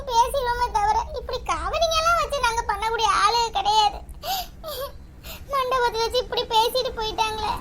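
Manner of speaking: crying. Acted. Mother weeping over her children's ruined wedding, crying. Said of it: "அண்ணி, அழாதீங்க".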